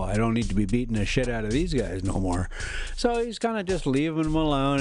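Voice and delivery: ordinary talk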